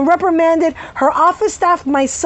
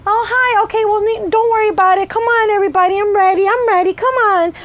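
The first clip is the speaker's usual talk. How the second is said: higher, syrupy-nice fake doctor voice; mock sweet voice; 7 semitones higher than usual. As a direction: sweeten it falsely like a two-faced doctor. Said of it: "a nice, light, soft voice".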